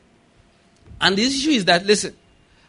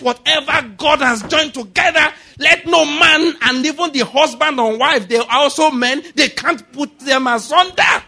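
preaching at full force; 5 semitones higher, 4 dB louder than usual. Acted. Pastor declaring victory over a marriage, loud preaching. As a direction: proclaim it over the house like a decree. Declaring prophecy in Jesus' name.

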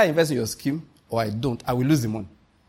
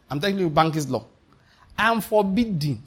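ordinary talk

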